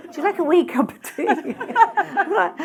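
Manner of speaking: giggling mock Scottish auntie offering tea; Scottish accent. Shown as a demonstration. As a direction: playfully put on a homely Scottish accent. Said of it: "And we say "we".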